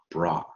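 bra with an open ah; pronounced bra. Shown as a demonstration. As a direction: say bra clearly. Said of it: "我们就念".

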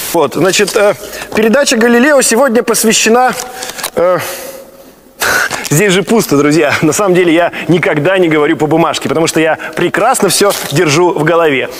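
loud showman host voice; TV host presenter. Acted. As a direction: announce like a TV presenter.

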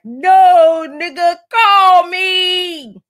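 high, loud crying-laughing yell; laughing shout. Retold or imitated. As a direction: shriek it through laughter. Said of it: "his pal is like".

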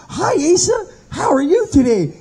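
put-on high, bright-eyed excited greeting from the old chaplain. Old chaplain greets the trembling inmate, unusually excited. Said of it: "today he's like".

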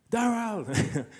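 put-on high, loud call in a Jamaican accent. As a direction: imitate a Jamaican mum calling out sharply. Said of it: "I probably won't continue in the Jamaican accent".

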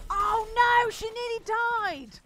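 loud shocked cry; shocked shout. Real, not acted. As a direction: yell it in real alarm. Near death in game.

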